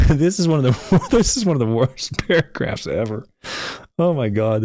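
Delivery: high, laughing through the words; can barely get it out for giggling; helpless giggles; laughing hard. Real, not acted. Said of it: "I'm gonna start crying".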